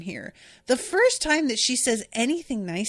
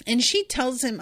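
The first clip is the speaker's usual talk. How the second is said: ordinary talk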